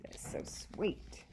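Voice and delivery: soft whispered reaction, slow. Real, not acted. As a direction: hush it, touched and slow. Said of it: "reader's whispered reaction".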